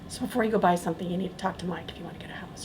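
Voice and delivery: quiet aside fading into a whisper; hushed aside. Real, not acted. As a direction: drop away to a whispered plug. Aside plugging the colleague.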